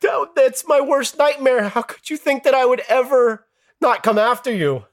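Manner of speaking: high, tearful, desperate protest; tearful pleading. Retold or imitated. Pleading with the dead friend in the dream, tearful. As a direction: beg him to believe you, near tears. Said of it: "I was pleading with him".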